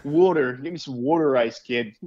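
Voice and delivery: "Water" as "wooder", Baltimore townie; Baltimore accent. Retold or imitated. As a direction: Baltimore guy ordering water ice. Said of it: "that mid-Atlantic accent".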